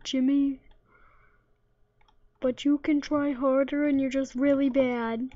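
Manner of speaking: mocking NPC voice. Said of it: "that's what his voice is like".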